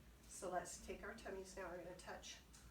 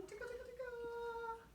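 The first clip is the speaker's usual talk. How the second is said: sing-song tickle; 13 semitones higher than usual. Shown as a demonstration. Leading kids in a finger warm-up.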